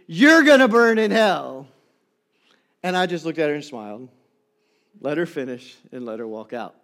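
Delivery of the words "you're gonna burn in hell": louder mock yell, finger pointed; retold yelling. Pastor imitating the woman pointing at him, mock yell. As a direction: curse him down the aisle. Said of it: "who was yelling throughout the hallway".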